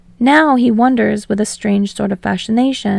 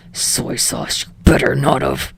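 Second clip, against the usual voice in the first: low menacing warning; threatening; 8 semitones lower than usual. Acted. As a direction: threaten under your breath. Bakugo threatens Sero.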